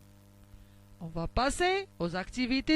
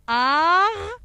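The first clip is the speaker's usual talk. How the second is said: "Arrosoir." beat out loud, higher, one clap per syllable; clapped syllables; 6 semitones higher, 6 dB louder than usual. Shown as a demonstration. Teacher claps the syllables with pupils, loud chant.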